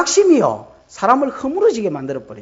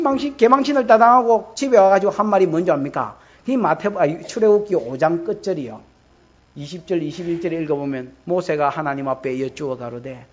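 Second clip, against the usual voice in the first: ordinary delivery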